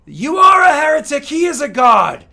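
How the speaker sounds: a voice from the crowd yelling, high and loud; outraged shout. Acted. Crowd member shouting at the accuser, outraged. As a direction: scream it from the back of the hall.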